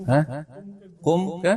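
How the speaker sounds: normal talking voice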